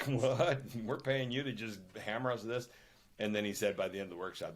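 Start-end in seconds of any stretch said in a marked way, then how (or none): 0.1-2.7 horrified. Retold or imitated. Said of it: "the guy was kinda horrified".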